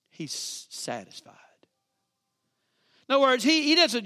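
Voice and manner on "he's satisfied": whisper. Acted. Savoring the word satisfied.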